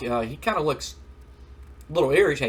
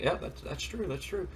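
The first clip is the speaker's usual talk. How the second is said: quiet agreement; 5 semitones higher, 9 dB quieter than usual. Retold or imitated. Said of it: "Vince McMahon's like".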